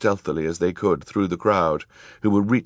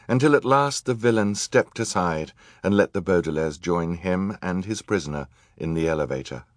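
plain reading voice

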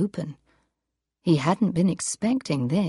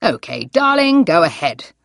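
cheerful voice; 7 dB louder than usual. Acted. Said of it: "he said in a cheerful voice".

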